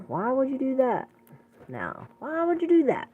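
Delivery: high, exasperated rebuke; incredulous scolding. Acted. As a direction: scold him like a let-down parent. Scolding question repeated.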